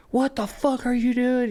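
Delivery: put-on high, slow, drawn-out yell of an angry old man; mock angry yell. Angry old man yelling at the narrator from his car. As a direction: bark it like the furious driver. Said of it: "he starts yelling".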